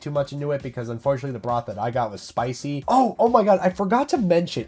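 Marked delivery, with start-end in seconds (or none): 2.9-4.6 surprised. Real, not acted. Suddenly remembers something.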